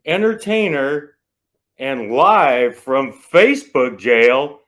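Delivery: booming drawn-out ring announcer intro. Pretend. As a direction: stretch every title like a fight announcer. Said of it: "announcer voice".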